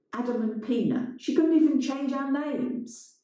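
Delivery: rising, outraged; indignant. A narrator's reading. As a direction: accuse her, voice climbing. Adam accusing.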